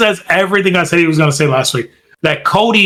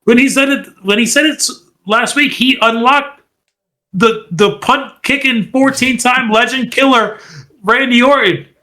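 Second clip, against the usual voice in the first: higher, building hype; excited buildup; 8 semitones higher than usual. Real, not acted. Podcaster hyping Randy's return, excited and higher. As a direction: pump up the crowd.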